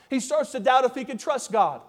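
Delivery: usual speaking voice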